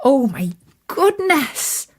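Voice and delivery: ordinary delivery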